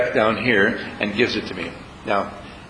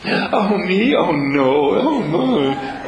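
high, breathy coy protest to a compliment; a higher mock-bashful lady gushing over a compliment; flustered flattered lady; 6 semitones higher, much slower than usual. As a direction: play a blushing woman waving off praise. Flattered woman acted in a story.

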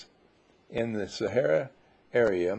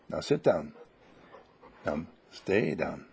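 firm command; 6 semitones lower than usual. Real, not acted. Commanding the dog.

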